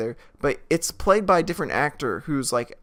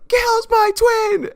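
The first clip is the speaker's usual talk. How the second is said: very high, loud, slow shriek; mock screaming; 9 dB louder than usual. [name] screams at the girlfriend, very high slow shriek. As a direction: screech it in overblown exasperation.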